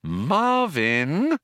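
sing-song "don't start" warning. A narrator's reading. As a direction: say the name as a caution. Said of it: "warned Trillian".

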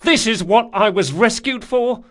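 high, loud bitter tirade; furious shouting. A narrator's reading. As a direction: rail loudly at the one who rescued you. Furious outburst at his rescuer.

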